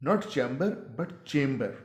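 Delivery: first chamber as CHAM-ber, second as CHAYM-ber; slow contrast, short a then long "ay" in chamber; contrasted pronunciations. Shown as a demonstration. (पहला chamber "चैम्बर", दूसरा chamber "चेम्बर")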